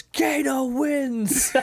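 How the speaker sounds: slow, high, drawn-out winner's yell; Kano victory shout. Retold or imitated. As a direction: boom it out like a fighting-game announcer. Quoting Kano's victory line.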